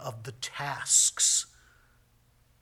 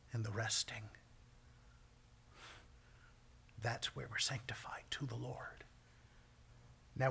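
hushed preaching; 3 semitones lower than usual. Acted. Preacher urging rest over busyness, in a hush. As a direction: draw the congregation into stillness. Quiet devotional close.

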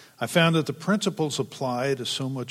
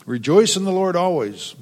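higher, louder bright sales pitch; mock slogan; 6 semitones higher, 7 dB louder than usual. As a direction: sell the verse like a coffee mug. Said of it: "marketing opportunity to sell coffee mugs".

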